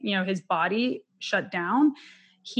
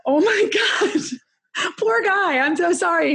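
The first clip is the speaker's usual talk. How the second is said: much higher, louder, put-on shocked voice, with a laugh; shocked bookseller; 9 semitones higher, 4 dB louder than usual. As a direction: play the stunned clerk over the top. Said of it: "And he was like".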